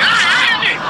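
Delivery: high shriek that breaks into a laugh; real squeal of laughter. Real, not acted. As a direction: let out a shrill cackle. Squeal and laugh after the mockery.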